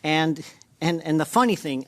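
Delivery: ordinary delivery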